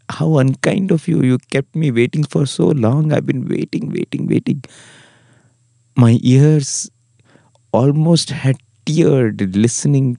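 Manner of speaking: high-pitched, emotional reproach, imitating a tearful master; tearful plea. Master to the disciple who came so late, acted tearful plea. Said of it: "tears streaming down his cheeks".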